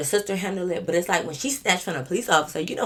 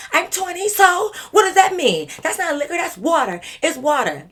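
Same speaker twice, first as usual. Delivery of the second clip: put-on defiant drunk; 11 semitones higher, 6 dB louder than usual. Drunk sister arguing with the officer that it is water, high and defiant. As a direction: argue back like a tipsy kid.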